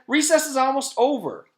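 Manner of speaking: loud, high urging; impatient. A narrator's reading. Terrence urging Louis because recess is ending. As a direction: plead in a hurry.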